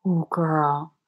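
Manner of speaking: ordinary talk, nothing marked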